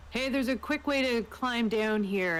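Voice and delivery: ordinary delivery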